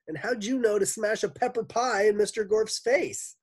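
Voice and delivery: put-on high, loud kid question; child Paul's voice. A narrator's reading. Puzzled child asks the lunch lady, high kid voice. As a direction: ask in a high schoolkid voice. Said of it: "she sounded like Paul".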